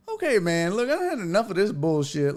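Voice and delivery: retold losing patience; fed-up protest. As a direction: cut him off, exasperated. Retells having had enough.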